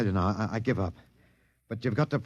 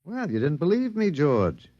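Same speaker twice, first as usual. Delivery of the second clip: higher, excited gotcha; pitched-up triumphant discovery; triumphant realization; 8 semitones higher than usual. Acted. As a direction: crow that they can die. Realizing the immortal aliens can die.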